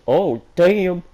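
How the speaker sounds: high, loud startled cry; real surprised yelp; shocked exclamation. Real, not acted. Reacting to the fic.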